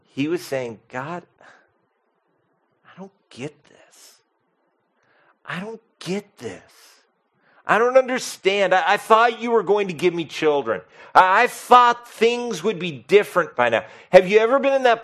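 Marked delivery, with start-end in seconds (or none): 0.9-4.2 barely audible, softer lament to God; hushed despairing prayer. Retold or imitated. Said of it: "Abram was crying out to God". 5.4-6.6 quiet rising plea. Retold or imitated. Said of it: "Abram was crying out to God". 7.7-13.8 higher and louder, pleading with God; anguished outcry. Retold or imitated. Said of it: "Abram was crying out to God".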